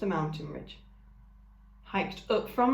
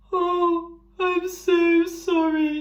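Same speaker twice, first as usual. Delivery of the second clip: much higher, loud, slow, tearful creature voice; blubbering ram; 11 semitones higher, 9 dB louder, much slower than usual. A narrator's reading. Ram apologising to the robot, blubbering. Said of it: "he blubbered".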